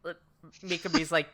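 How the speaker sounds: ordinary talk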